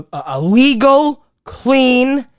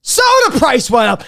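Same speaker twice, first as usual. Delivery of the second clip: mock outraged shout; 4 semitones higher than usual. Comic at the store shouting about the soda price. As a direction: yell it like a personal betrayal.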